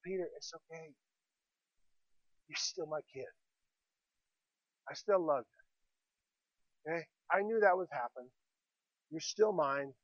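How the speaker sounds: very soft, low, unhurried comfort; Jesus, tenderly reassuring. Acted. Jesus to devastated Peter on the beach, tenderly reassuring. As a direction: reassure gently, almost under the breath, with pauses. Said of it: "I can only imagine Jesus".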